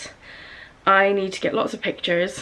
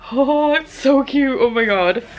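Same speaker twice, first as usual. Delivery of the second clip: delighted; 5 semitones higher, 5 dB louder than usual. Real, not acted. Seeing event t-shirt.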